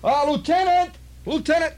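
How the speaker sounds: high-pitched hollered call, repeated; mock holler to summon the officer. Sergeant turning to holler for the lieutenant, high call. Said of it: "turned and hollered".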